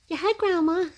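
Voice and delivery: put-on high, cooing baby voice. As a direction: speak for the baby, high and sweet. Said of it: "speaking for the baby".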